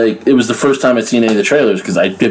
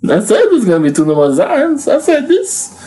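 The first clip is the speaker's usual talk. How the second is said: high-pitched comic character; squeaky plug-reading character; silly character voice; 14 semitones higher than usual. Acted. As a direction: keep a high, put-on cartoon voice. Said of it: "do my plugs in a character voice".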